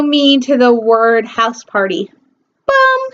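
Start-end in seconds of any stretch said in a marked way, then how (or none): 2.7-3.0 dramatic bum. Acted. Dramatic reveal sound effect.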